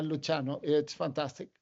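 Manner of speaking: ordinary delivery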